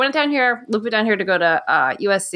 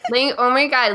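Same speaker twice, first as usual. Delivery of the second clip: put-on bubbly Valley girl gush; 4 semitones higher than usual. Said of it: "talk like a Valley girl".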